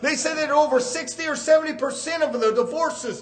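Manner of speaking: forceful, urgent sermon; preaching. Real, not acted. Preacher condemning divorce in America, forceful preaching. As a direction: hammer the warning at the congregation.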